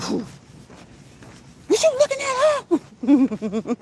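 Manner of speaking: very high accusing squeal; jealous accusation. Retold or imitated. Older woman accuses her man of looking, very high. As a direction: catch him looking, shrill and suspicious.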